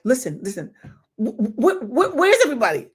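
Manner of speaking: rising panicked plea to the agent; frantic. Retold or imitated.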